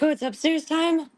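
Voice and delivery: voicing the confused pets. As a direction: put on a puzzled pet voice. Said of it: "They're like".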